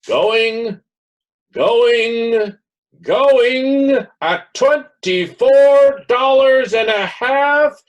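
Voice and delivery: loud, high, slow auction cry; auctioneer's call. Acted. Auctioneer calling out bids.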